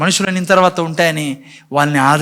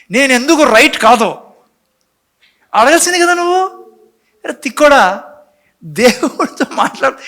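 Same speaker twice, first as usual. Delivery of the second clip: exasperated scolding; 7 semitones higher, 3 dB louder than usual. Real, not acted. Preacher scolds Cain directly.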